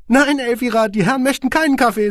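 high, cold and curt; icy squeaky boss. A narrator's reading. Boss tells his secretary off, high and icy. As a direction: freeze her out in a tiny high voice. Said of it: "sagte Van Hirsch eisig".

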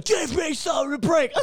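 high, wailing fake sob; hammed-up crying demand; mock bawling. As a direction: wail it like sobbing into angry music. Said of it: "I'll be bawling, going".

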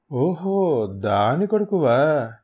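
slow, drawn-out mocking; sneering taunt. Thug mocking the hero's mother, slow sneer. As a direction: drag out the insult.

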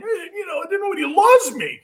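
high mock whine; hammed-up crybaby quarterback; whining quarterback. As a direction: whine like nobody loves you. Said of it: "these guys are always whining".

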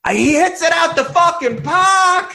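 high, drawn-out Boston yell; imitating a slow, high-pitched Boston bellow; Boston accent shout. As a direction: holler it like a Boston sports fan. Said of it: "Ugh, the Boston".

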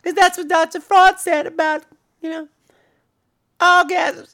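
very high, breathy falsetto giggle. Retold or imitated. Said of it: "what sounds like [name]".

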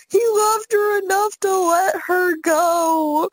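slow, high mock sob; imitated crying. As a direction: wail it slowly, mimicking a weeping friend. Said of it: "she will cry that exact same sentence".

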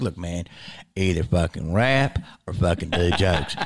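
very low imitated manager voice; manager's gruff advice. Manager warning a young comic, imitated low gruff voice. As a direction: drop right down and lay down the rule. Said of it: "He was like".

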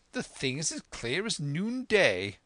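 the squire crowing at the discovery; excited squire's cry. A narrator's reading. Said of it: "cried the squire".

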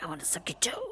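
whispered mock Bond line; whispered Brosnan parody; mock Pierce Brosnan. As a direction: breathe it like a sleazy Bond. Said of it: "an impression of you doing Pierce Brosnan".

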